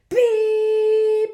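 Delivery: loud high held beep; loud high alarm beep. Acted. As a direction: imitate a blaring alarm. Alarm button pressed.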